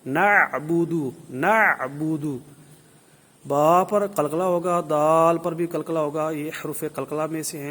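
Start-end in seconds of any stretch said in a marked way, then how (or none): 0.1-2.4 slow, loud نعبدو with a clear throaty ain; ain drill. Shown as a demonstration. Teacher drilling students on the ain, slow and loud. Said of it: "یہ بار بار practice کریں".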